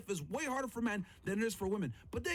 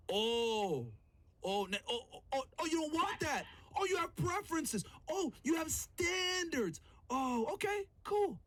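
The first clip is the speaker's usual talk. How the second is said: sarcastic mock surprise; 7 semitones higher than usual. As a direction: pitch climbing higher with each mocking "oh". Sarcastic reply to women's preferences.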